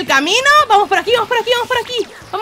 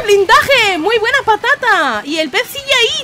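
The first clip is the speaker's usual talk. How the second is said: ordinary talk, nothing marked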